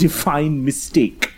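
normal talking voice

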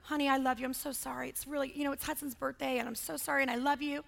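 soft, soothing parent mode; hushed, tender apology; empathetic nurturing. Acted. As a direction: gently reassure an upset child. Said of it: "it's, it's empathy, nurture".

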